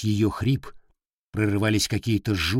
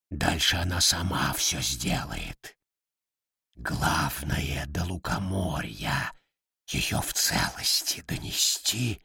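low, soft, slow otherworldly voice; a dark power whispering its orders; whispery demon voice; 6 semitones lower, 6 dB quieter, slower than usual. Acted. Darkness speaks through hen.

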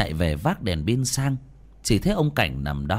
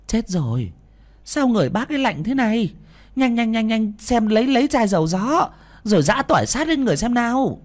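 high, urgent, louder cries; alarmed urging; 13 semitones higher, 4 dB louder than usual. Acted. Neighbour calling for oil and garlic, alarmed urging. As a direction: hurry everyone along in a panic. Woman alarmed at cold body.

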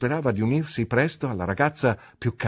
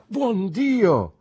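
high, loud cry of astonishment; excited cry; 12 semitones higher, 5 dB louder than usual. A narrator's reading. Traddles looks up and sees his old friend, astonished cry. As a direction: cry out at a sudden wonderful surprise. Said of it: "gridò Traddles".

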